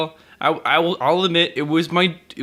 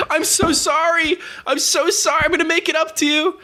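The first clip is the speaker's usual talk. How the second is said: mock tearful apology; 10 semitones higher than usual.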